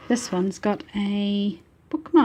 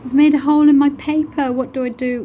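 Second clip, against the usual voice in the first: higher-pitched, hurried mock student plea; imitating a student; 7 semitones higher, 7 dB louder than usual. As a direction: play the flustered pupil who tore her paper. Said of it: "if I've heard it once".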